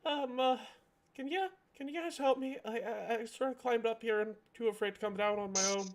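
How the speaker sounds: high, soft, quick timid voice; little voice. Acted. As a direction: squeak it out like a scared little creature. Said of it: "you hear a little voice from the tree".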